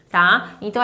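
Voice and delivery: ordinary delivery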